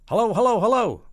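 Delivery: very high, loud repeated hellos; urgent phone shout. Acted. President calling Peking on porcelain phone, urgent shout. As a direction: shout for an answer, pitched right up.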